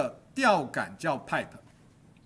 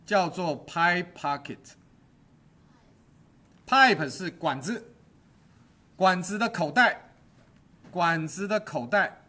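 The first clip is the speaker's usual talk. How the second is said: slow and loud, word by word for notes; slow dictation; 4 dB louder, slower than usual. Shown as a demonstration. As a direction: spell it out slowly for the class to copy. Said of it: "来记下来". (放慢、加重，一字一字念给学生抄)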